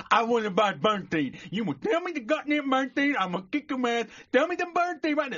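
high, loud mangled threats; furious garbled customer. Acted. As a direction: bellow mushed words in an acted rage. Customer threatens shopkeeper in joke.

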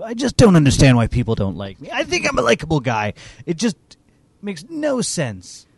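plaintive mumble to himself; sulky, sorry-for-himself voice; whiny self-pity. Acted. GM voices Tim talking to himself.

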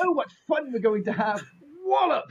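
high sing-song cheer, then yelled Wallop; hammed-up eager naive fighter; mock cheerful fighter. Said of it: "Like that".

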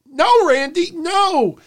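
high-pitched hammed-up cry of no; squeaky mock protest; mock high wail. Repeating referee mimicry.

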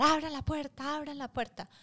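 high mock shout at the door; dad yelling. Retold dad demanding the kids open the door, in a high mock shout. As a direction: bang on the door and bark the order.

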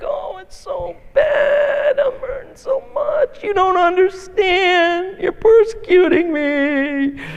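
very high, slow, hammed-up moaning; milk the little wound for pity; overdone wounded wail; self-pitying whine. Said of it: "we just nurse it".

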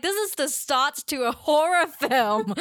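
giggling horror-movie voice; laughing mock dread. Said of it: "to girls, it's like".

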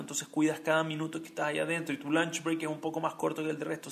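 usual speaking voice

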